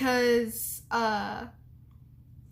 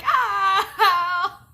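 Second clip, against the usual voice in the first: sharp high yelp of pain, much louder; pained cry; 8 semitones higher, 9 dB louder than usual. Retold or imitated. Retelling the painful moment, a loud high cry of pain. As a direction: cry out like it suddenly hurt. I was crying.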